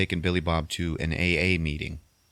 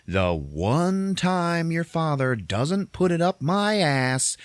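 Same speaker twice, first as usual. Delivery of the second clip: higher, drawn-out exasperated complaint; exasperated rant; 10 semitones higher, much slower than usual. Retold or imitated. Mom complaining to her son, exasperated. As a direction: stretch it out in mock exasperation.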